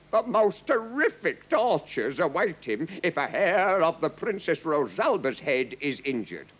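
threatening captain. A narrator's reading. Captain warning the king over Rosalba, threatening. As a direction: deliver the threat grandly.

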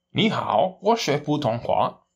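careful, slow Mandarin learner's greeting in a Mandarin accent; Mandarin phrase attempt. Shown as a demonstration. Spanish-speaking teacher shows off his beginner Mandarin, slow and careful. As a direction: sound out the Mandarin words slowly, like a beginner. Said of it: "Todavía no puedo hacerlo bien".

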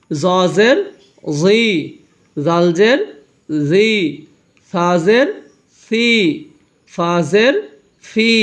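measured sing-song, each letter name swooping upward; recitation drill. Shown as a demonstration. Teacher asking pupils to say jer sounds along, rising drill chant. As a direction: chant it for pupils to repeat, pausing after each syllable. Leads learners repeating after him.

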